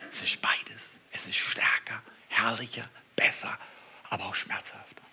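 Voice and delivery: soft, intimate sermon whisper; hushed preaching. Acted. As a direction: draw them in quietly. Preacher drops to reflective whisper. (leise, eindringliche Predigt)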